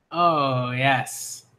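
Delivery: normal talking voice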